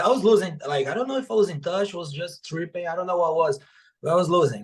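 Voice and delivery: normal talking voice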